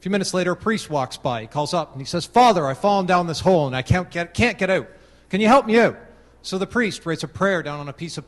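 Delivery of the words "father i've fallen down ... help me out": louder and higher, calling up from the bottom of a hole; calling up for help. Acted. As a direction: shout up to the priest, rising to a plea on the last line. Man in hole calls up.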